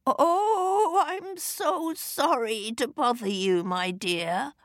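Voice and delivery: put-on high, quavering old lady; wobbly frail old woman voice. A narrator's reading. Fairy disguised as old lady, quavering and high.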